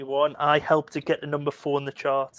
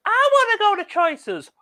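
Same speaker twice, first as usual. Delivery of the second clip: put-on much higher, slow childish pleading; little kid voice; 6 dB louder than usual. Host imitating a film kid begging to go clubbing, in a high, slow child voice. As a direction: squeak it out like a small kid begging. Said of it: "a little kid then goes".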